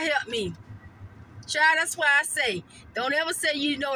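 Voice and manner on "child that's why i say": normal talking voice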